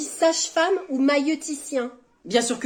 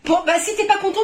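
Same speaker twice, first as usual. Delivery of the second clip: higher, piqued retort; offended snap; 8 semitones higher, 4 dB louder than usual. Acted. Masseur offended by partner's teasing, piqued. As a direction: take offence and threaten to stop.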